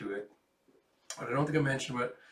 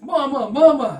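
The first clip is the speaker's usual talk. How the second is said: put-on child crying out; 13 semitones higher, 16 dB louder than usual. Child cries out in shelter.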